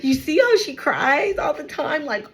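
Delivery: gleeful mean-gossip act, breaking into laughter; mocking gossip. Bullies making fun of someone who cries, mocking. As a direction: play a bully snickering about a victim. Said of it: "they would make fun of you".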